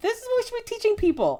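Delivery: high, fast emphatic outburst. Retold or imitated. Reacting to a green flags post, high and fast. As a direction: blurt it high and quick like a revelation. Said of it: "And I'm like".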